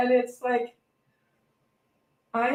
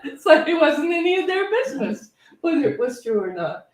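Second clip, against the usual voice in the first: laughing while talking; 8 semitones higher, 4 dB louder than usual. Real, not acted. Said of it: "Did they ever find out".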